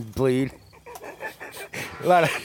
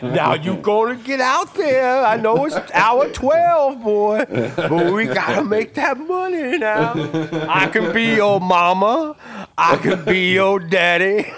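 higher, slow hammed-up folksy coaxing; mock manager coaxing; 7 semitones higher, slower than usual. Tom Hanks as manager on set, hammed-up coaxing. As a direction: coax him like a fussing old mama.

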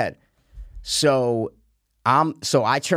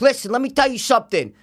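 high mocking imitation; confrontational man; 10 semitones higher, 3 dB louder than usual. Older golfer confronting the speaker, blustering. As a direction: mimic a blustering challenge, pitched up.

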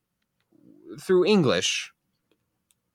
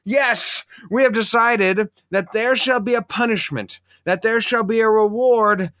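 mock grand announcement; 7 semitones higher, 4 dB louder than usual.

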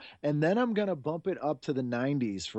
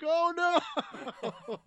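high Tick-style exclamation. Retold or imitated. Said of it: "And I was like".

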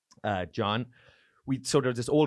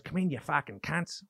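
higher, broad mock Aussie; Australian accent; 4 semitones higher than usual. Comic imitating Colin greeting new guests, broad Australian accent. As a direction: crude welcome in Australian accent. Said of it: "I'm doing this Australian accent".

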